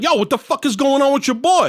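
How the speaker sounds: mock exasperation, high; indignant confrontation. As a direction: imitate a father accosting someone. Father confronts the player's friend.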